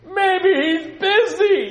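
high, loud, very slow sarcasm; mocking taunt. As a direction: stretch it out to goad them. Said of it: "he was egging him on".

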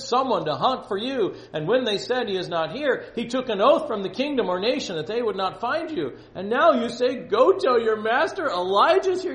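high, loud, impassioned plea; dramatic scripture reading. Acted. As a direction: read it out as a desperate servant protesting.

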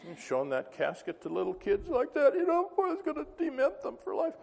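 high-pitched mock outrage; scandalised complainer. Upset churchgoer scolding the preacher about a casket, high mock outrage. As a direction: put on a squeaky, scandalised objector.

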